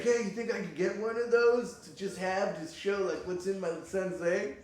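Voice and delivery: crazy dad. Retold or imitated. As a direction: play the dad keen on a souvenir. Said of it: "He's like".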